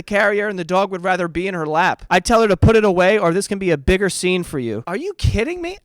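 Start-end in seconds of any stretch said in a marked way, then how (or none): 4.9-5.8 "you" shot up high in disbelief; high-pitched, outraged disbelief on "you"; incredulous. Acted. Reacting to the dog lady, high incredulous "you".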